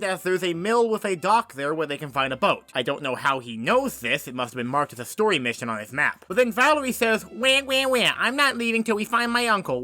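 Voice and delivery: ordinary talk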